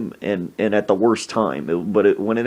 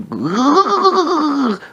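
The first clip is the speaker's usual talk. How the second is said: cold shock gasp; 3 dB louder than usual. Retold or imitated. Reacts to ice-cold lemonade.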